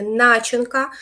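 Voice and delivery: "начинка" with НА hit hard; stress on first syllable. Shown as a demonstration. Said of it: "наголос на перший склад".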